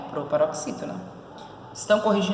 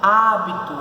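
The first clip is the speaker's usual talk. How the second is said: Hábito with a long, loud HÁ; stressed syllable stretched; 5 dB louder, slower than usual. Shown as a demonstration. Teacher showing the tonic syllable, Hábito with HÁ emphasized. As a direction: punch the HÁ in Hábito. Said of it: "Qual é a sua posição da sílaba tônica?".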